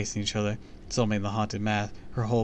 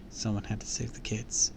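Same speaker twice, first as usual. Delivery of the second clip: usual speaking voice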